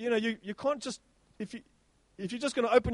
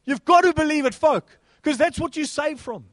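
loud, higher exhortation; fervent shout; 6 semitones higher, 8 dB louder than usual. Acted. Preacher urges belief in salvation, loud and high. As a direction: urge them at full force.

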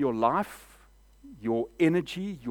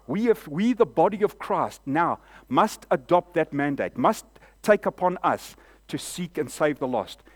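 loud and rising, pressing the mandate home; fervent preaching; 3 semitones higher, 4 dB louder than usual. Real, not acted. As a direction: preach it hard to the church. Preaching at full force.

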